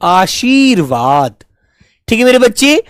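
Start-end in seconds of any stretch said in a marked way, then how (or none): 0.0-1.3 आशीर्वाद said slowly with a short र before व; careful pronunciation. Shown as a demonstration. Teacher showing pupils how आशीर्वाद sounds. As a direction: stretch आशीर्वाद, touch the र briefly. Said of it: "थोड़े से समय के लिए बोला जा रहा है".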